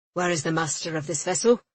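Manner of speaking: a pompous English port official demands; persnickety officiousness. A narrator's reading. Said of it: "clipped with the persnickety officiousness".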